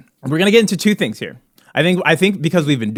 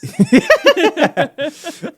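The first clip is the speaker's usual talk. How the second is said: high laugh; 11 semitones higher than usual. Real, not acted. Laughing at cohost.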